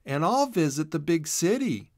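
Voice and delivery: a hint of a bright rabbit girl; Boodle voice. A narrator's reading.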